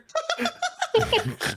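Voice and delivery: high burst of laughter. Real, not acted. Telling friends about the dumpster, bursting into high laughter. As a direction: break up laughing at the story. Laughs at his own story.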